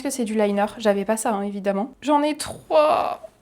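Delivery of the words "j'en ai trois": high, drawn-out cry of dismay; upset, stretched-out high exclamation; dismayed wail. Real, not acted. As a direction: wail it slowly, high in pitch.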